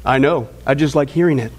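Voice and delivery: low, dry reply with a smile; smiling deadpan. Retold or imitated. Don answering the fed-up Marine, low smiling deadpan. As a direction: let the punchline land low and sly. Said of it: "Don just smiles and says".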